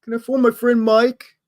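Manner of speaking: put-on high, pleading child asking permission; whiny kid voice. Child asks mommy to phone friend, high pleading kid voice. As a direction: play the kid begging to call his friend.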